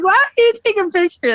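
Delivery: high, fast incredulous question. Retold or imitated. Mom asking boyfriend why he photographed the diaper, incredulous. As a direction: fire it back in disbelief. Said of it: "And I'm like".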